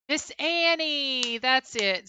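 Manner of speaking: finally remembering the name, stretch it out gladly; sudden recognition. Real, not acted. That's it.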